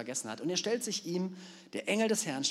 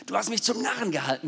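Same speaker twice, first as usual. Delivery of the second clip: shouted, high and furious; angry cry; 9 semitones higher, 8 dB louder than usual. Acted. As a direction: yell at the donkey. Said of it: "rief Biliam".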